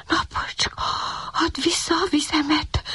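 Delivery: hushed whisper to himself; puppy whispering his plea to the sun. A narrator's reading. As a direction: breathe it out as a secret wish. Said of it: "suttogta magában a kiskutya".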